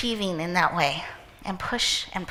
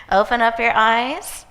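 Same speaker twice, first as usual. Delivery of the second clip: usual speaking voice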